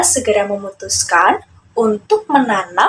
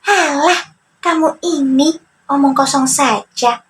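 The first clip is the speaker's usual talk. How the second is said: higher, scoffing character voice; cocky young trickster, brushing it aside; Joni dismissive; 4 semitones higher than usual. Acted. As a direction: wave off the advice.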